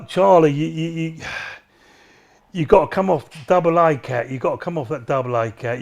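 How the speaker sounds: quiet, earnest appeal to a friend; quiet heartfelt message to Charlie; soft sincere plea. Real, not acted. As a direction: speak gently, begging him to change.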